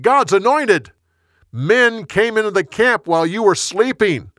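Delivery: high, loud rebuke across distance; accusing shout. A narrator's reading. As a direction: call out the accusation to a far camp. David still calling across to camp.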